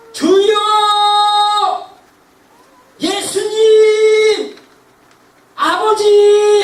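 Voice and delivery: loud, high, long-drawn calling out; prayer cry. Shown as a demonstration. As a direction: cry each name out at full force, very slowly. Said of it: "우리가 기도할 때". (길게 부르짖는 통성기도)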